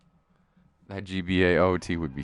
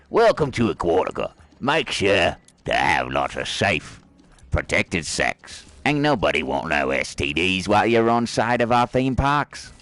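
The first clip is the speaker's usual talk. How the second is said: hammed-up fake Australian theme park announcer; lightly put-on Australian accent. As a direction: imitate an obnoxious Australian park guide, slowing on the warning. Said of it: "terrible Australian accent guy".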